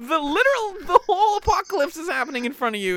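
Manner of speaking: high, loud disbelief; incredulous. Real, not acted. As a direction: exclaim it in amused disbelief, high. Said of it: "And it's like, yo".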